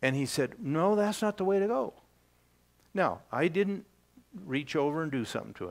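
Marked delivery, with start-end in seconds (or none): 0.6-1.9 a lightly voiced know-it-all grandson, a bit higher; mouthy tone. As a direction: mimic a kid's know-it-all correction, lightly. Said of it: "get that tone of voice out of your".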